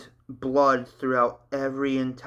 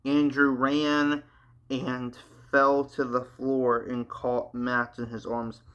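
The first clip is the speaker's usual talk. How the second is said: plain reading voice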